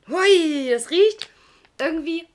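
high, loud delighted gasp; excited. Real, not acted. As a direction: burst out at the smell. Sudden strong candy smell.

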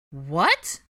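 loud angry what; angry demand. Acted. Hermione and Molly demanding angrily, loud. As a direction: demand an answer. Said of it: "demanded angrily".

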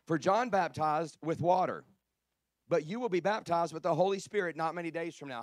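loud, emphatic scripture reading; preaching scripture. Real, not acted. Preacher reading Acts to his congregation, loud and emphatic. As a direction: proclaim the verse forcefully to a congregation.

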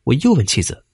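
plain reading voice

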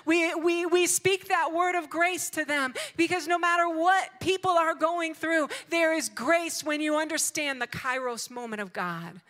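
high, loud, emphatic preacher's delivery; full-force preaching. Real, not acted. Preacher urging grace for the troubled, full-force preaching. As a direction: proclaim it to the congregation, loud and high.

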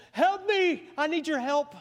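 high, loud pleading outburst; urgent cry for help. Acted. Mary turns to Jesus with her problem, urgent cry. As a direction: cry out as if desperate.

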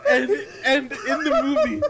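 high, loud and slow through laughter; laughing while telling. Real, not acted. Telling friends dad's absurd movie idea, laughing hard. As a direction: struggle to get the punchline out while laughing.

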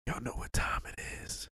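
breathy hushed intro line; whispered show catchphrase. Acted. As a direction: whisper it like a secret signature.

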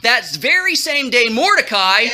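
usual speaking voice

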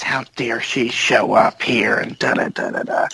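low, whispered retold outrage; hushed mock indignation; mock pissed-off. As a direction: seethe under your breath, imitating her.

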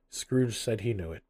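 plain reading voice

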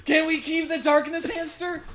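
loud, high-pitched excited plea; excited pleading. Acted. Player joins the plea to keep the hamster, high excited begging. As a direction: beg for the pet at the top of your voice.

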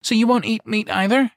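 put-on high, loud cheeky child's voice; sly little girl. A narrator's reading. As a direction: bargain like a sly ten-year-old. Daughter bargains with a sly grin.

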